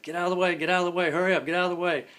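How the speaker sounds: high, loud, pushing through a crowd; urgent shouting. Retold or imitated. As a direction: bark it to clear the hallway. Clearing a path through kids.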